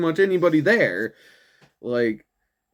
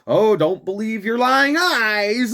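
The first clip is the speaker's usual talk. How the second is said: slow, higher, sing-song condescension; mock patronizing politician; 7 semitones higher than usual. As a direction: talk down like a smug spin doctor. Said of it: "the Democratic Party again going".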